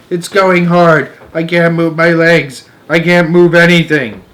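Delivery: high, loud moan. A narrator's reading. Centipede moaning as the paint dries. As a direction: whine it out in distress. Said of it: "he moaned".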